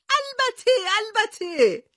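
very high squeaky "البته"; shrill squeaky voice. A narrator's reading. Professor agrees with the captain, squeaky high. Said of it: "با صدای جیغ مانندش گفت".

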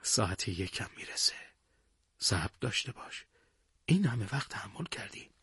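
calm him down gently, keeping the voice down; quiet, patient answer. A narrator's reading. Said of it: "با لحن آرومی جواب داد".

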